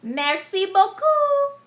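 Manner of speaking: high, loud and slow; crocodile's triumphant cry. Acted. Monsieur Crocodile gloating at the trapped gingerbread boy, high, loud and slow. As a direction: gloat as you drop the mask. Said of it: "cried Monsieur Crocodile".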